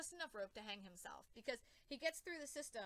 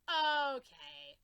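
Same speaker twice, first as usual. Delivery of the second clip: loud okay; 3 semitones higher, 11 dB louder than usual. Retold or imitated. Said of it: "I'm like".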